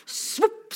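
high-pitched suction pop; imitated boot squelch. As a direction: make the funny mud-sucking sound. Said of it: "det lager så artig lyd". (etterligner støvel som suges opp av gjørma)

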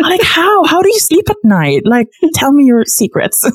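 amazed disbelief. Real, not acted. Amazed at tea-drinking coworkers.

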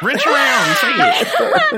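high, loud laughing shout. Real, not acted. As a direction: yell it out through laughter. Friends egging on laughing.

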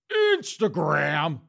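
slow, loud, stretched; slow loud drawl; drawn-out word. Retold or imitated. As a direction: linger on it teasingly. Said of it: "Daddy Queen was like".

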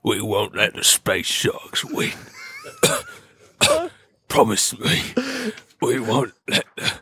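slow, strained dying words; wounded soldier gasping. Acted. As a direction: gasp it out like your last breath. Dying pig's last words, acted.

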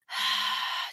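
long breathy sigh; heavy sigh. Real, not acted. Mother recalling her shopping trip, long sigh. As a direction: let out a weary breath.

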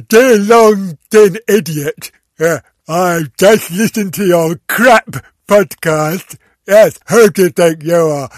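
loud, slow mocking voice of the rude listener; silly put-on voice. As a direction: read the insult in a ridiculous hammed-up voice. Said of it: "which voice should I give it?".

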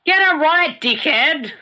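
sudden high yell; shouting match. Pretend. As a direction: yell it at her, playfully mock angry. Mock argument while watching movie.

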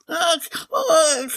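high, shrill-edged anguished cries; high pained ugh-ugh cries over her fainted lover; screaming sobs. A narrator's reading. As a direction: wail out the Countess's distress. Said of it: "screamed the Countess".

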